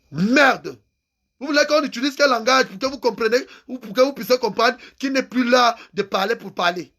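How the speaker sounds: normal talking voice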